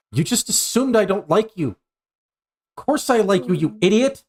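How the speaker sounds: high-pitched indignant telling-off; exasperated and high, affectionately insulting; exasperated scolding. Acted. GM acts Asuka scolding a friend.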